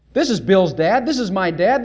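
ordinary talk, nothing marked

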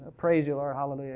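soft, dutiful mock praise mumbled without heart; going through the motions. Preacher mocks a bored worshipper's praise, soft and dutiful. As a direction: toss off the praise like a chore. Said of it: "just go through the motions".

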